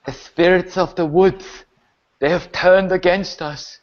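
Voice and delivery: strained corpse voice in separate phrases; revived Hazel. Acted. Corpse answers where the spirits came from, strained. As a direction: give a grave warning from beyond death. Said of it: "at the corpse of Hazel".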